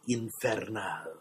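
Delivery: Infernal stressed on its last syllable, "-NAL"; stress on final syllable. A narrator's reading. Narrator naming the hottest pepper, Infernal stressed on the final syllable. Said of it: "Chili mavens stress the final syllable".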